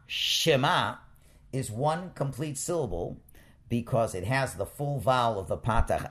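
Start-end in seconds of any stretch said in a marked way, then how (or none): 0.1-1.0 Shema with short 'she' gliding into 'ma'; Shema, slowly sounded out. Shown as a demonstration. Teacher sounding out Shema for Hebrew students, clear and slow. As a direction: say 'Shema' as one smooth word, she-MA. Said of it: "pronounced Shema".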